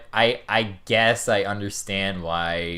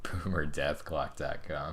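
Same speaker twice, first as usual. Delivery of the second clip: breathy whisper, softer; whispered echo; 6 semitones lower, 11 dB quieter than usual. Real, not acted. Echoing his co-host's website name in a whisper. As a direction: murmur the name under your breath.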